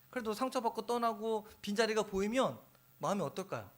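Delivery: normal talking voice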